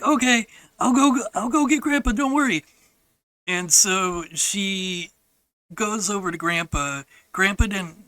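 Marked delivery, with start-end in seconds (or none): 0.0-2.6 high, put-on child's voice; mimicked sister. Sister to brother in the hole, high mimicked assurance. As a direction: imitate a girl promising help. Retold sister promising to fetch help.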